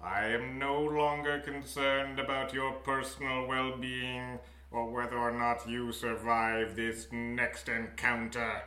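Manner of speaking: slow, dramatic scene-setting narration; game master narration. Acted.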